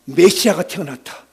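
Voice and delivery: ordinary delivery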